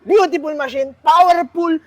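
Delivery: high, loud, pumped-up pitch; a high, loud, thrilled promo; hyped sales pitch. Real, not acted. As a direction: sell it while still buzzing. Hyped pitch after test ride.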